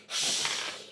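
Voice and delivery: breath-only hissing noise; cat hiss. Acted. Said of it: "he hisses at you and goes".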